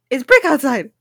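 repeated at a firmer, fuller volume; puzzling aloud. Real, not acted. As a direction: say it out loud to work it out. Repeats the clue louder, puzzling.

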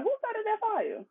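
high, slow, soft feigned innocence; mock innocent. Gaslighter pretending not to know about the fire, mock innocent. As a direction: play dumb with a laugh in it. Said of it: "they're like".

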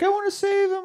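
very high little squeal; mock pleading Trudy. Trudy wanting to save Santa, high mock pleading. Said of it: "Trudy's like".

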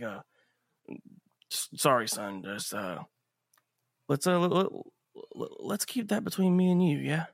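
halting, stammered awkward apology; flustered apology. Acted. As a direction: stumble over the words, leaving uneasy gaps. GM voices flustered Sanders apologizing.

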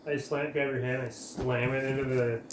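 usual speaking voice

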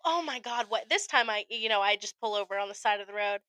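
normal talking voice

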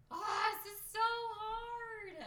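high, slow, straining whine; strained high whine. Retold or imitated. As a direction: whine it like lifting hurts. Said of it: "and I'm like".

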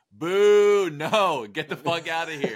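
high, loud mock booing, then heckling; jeering crowd. Parliament rejecting Napoleon's speech, loud mock jeering.

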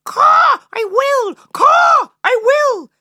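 shrill crow cry, very high and loud; crow cawing. Acted. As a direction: screech it like an angry crow.

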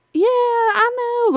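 very high, drawn-out awkward yeah; uncomfortable agreement. Retold or imitated. Coworker humoring a ranting customer, uncomfortable. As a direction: imitate someone squirming while agreeing. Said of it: "you could tell he was uncomfortable".

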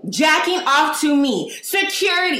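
slow, high screamed alarm, over and over; high-pitched yelling. Retold or imitated. Shopper alerting the whole store about a man, high-pitched yelling. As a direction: yell it like a fire alarm, stretched and high. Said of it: "that's how it was repeated".

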